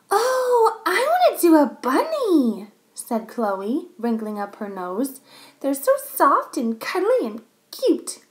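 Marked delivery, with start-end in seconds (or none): 0.1-2.7 put-on high, loud gushing child voice; excited little girl. A narrator's reading. Girl telling teacher she wants a bunny, excited high gush. 5.6-8.2 high-pitched cooing over something cute; gushing little girl. A narrator's reading. Girl gushing to the class about bunnies, high girlish delight.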